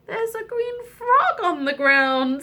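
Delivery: high princess voice; high-pitched storybook princess. Acted. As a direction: announce a discovery in a high voice. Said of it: "said the princess".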